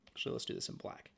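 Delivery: soft, fast whisper to herself; whispered aside. Real, not acted. Teacher switching pen colour, whispering to herself. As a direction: mutter the aside under your breath.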